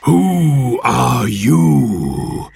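slow, drawn-out monster bellow; monster's yell. Acted. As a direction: yell it slowly as an angry monster. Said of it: "it yelled".

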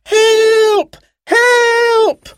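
very high, long drawn-out yells; shouting for help. A narrator's reading. Sarah tries to attract the helicopter pilot, shouting loudly. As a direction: shout for help at the top of your voice. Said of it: "she shouted as loudly as she could".

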